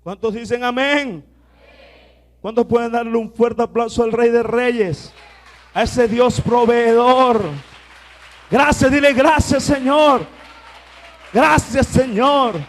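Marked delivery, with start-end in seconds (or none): 2.4-12.6 shouted praise. Acted. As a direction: rouse the crowd to applaud. Call for applause.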